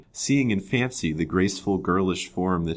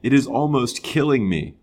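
louder, higher anguished cry; impetuous outburst; 7 semitones higher, 6 dB louder than usual. A narrator's reading. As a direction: burst out in narrated distress. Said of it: "to an outburst he had not intended".

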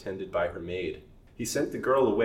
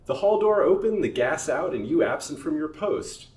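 higher, louder stern rebuke; scolding; 8 semitones higher, 7 dB louder than usual. Acted. Master rebukes Willis for leaving his post, stern. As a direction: scold the negligent servant.

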